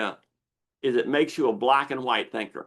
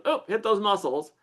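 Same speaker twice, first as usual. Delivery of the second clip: lizard brain voice; 8 semitones higher than usual. Acted. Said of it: "the lizard brain goes".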